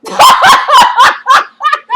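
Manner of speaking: big high burst of laughter; real laughter, way up high and loud. Real, not acted. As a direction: crack up out loud. Cracking up at Jocelyn's comeback.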